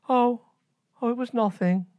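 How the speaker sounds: put-on high, dismissive girlish voice; high fibbing princess; evasive princess.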